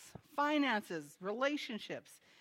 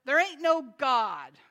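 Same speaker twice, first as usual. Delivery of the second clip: loud, drawn-out rebuttal; loud mock-angry holler; angry retold shout; 2 semitones higher, 9 dB louder, slower than usual. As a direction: shout it back slowly at the neighbor. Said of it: "and would shout".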